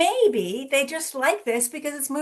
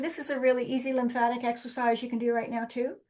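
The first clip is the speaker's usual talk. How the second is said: usual speaking voice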